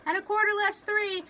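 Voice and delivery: sharpened voice. Acted. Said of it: "Watson's voice sharpened".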